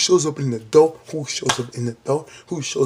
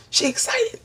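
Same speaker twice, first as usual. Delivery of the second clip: "She excited" squealed very high with a rising end. Shown as a demonstration.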